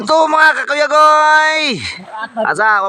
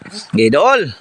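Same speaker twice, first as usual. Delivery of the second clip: normal talking voice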